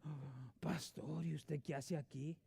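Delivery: soft, slow mock surprise; hushed surprised churchgoer. Churchgoer surprised to see pastor at Walmart, soft and slow.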